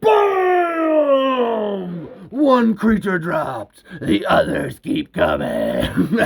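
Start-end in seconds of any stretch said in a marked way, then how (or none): 0.0-2.1 high, long shouted boom; booming gunshot imitation. A narrator's reading. Narrator imitating the rifle shot, booming. 4.0-6.2 very low, slow ominous narration. A narrator's reading. Narrator as more creatures advance, ominous. Narrator voices looming menace.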